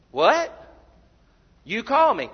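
acted startled reply to a caller; roused, questioning. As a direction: call it out like someone just roused. Roused priest answers the boy.

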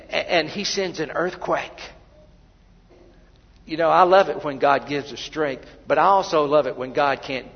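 ordinary delivery

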